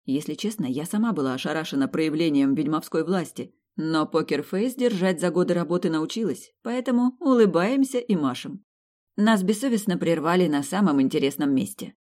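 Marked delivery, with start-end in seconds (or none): none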